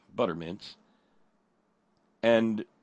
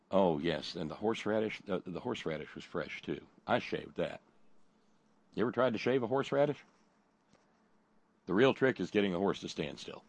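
ordinary talk, nothing marked

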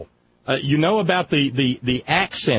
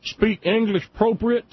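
folksy rural accent; 7 semitones higher than usual. Shown as a demonstration. Mocking a thick folksy accent while asking about teachers. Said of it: "somebody who talks like this here".

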